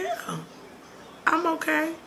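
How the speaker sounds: mildly weak voice; weak reply. Retold or imitated. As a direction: answer feebly. Weak reply while sick.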